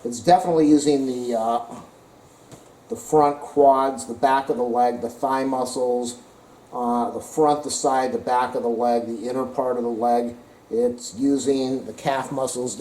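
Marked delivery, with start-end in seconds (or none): none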